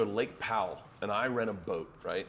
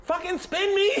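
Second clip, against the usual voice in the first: loud, very high mock demand; Bobby as impatient child; 6 dB louder than usual. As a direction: yell it like a bored, petulant child. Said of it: "like a fucking child going".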